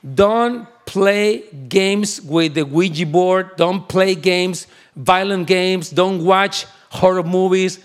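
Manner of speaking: slow, deliberate preacher's warning; grave sermon caution; earnest warning. Acted. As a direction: spell it out slowly for the young people. Slow English warning to youth.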